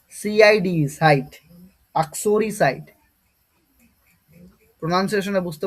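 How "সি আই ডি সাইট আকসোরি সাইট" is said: each letter and site said slowly and deliberately; slow spelling demo. Shown as a demonstration. Teacher building up site from its letters, slow. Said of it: "উচ্চারণটা এরকম হবে".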